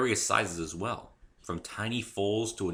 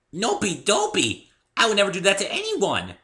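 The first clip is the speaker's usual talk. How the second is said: flustered Pinkie Pie; 12 semitones higher, 10 dB louder than usual. A narrator's reading. Pony denying she tore the page, high and upset. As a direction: protest your innocence like a hurt pony.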